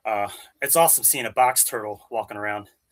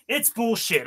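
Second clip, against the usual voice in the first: higher-pitched, indignant outburst; exasperated; 10 semitones higher, slower than usual. Real, not acted. Resident venting about state fees, exasperated. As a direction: say it with rising exasperation.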